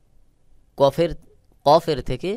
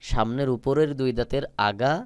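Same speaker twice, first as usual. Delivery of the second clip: usual speaking voice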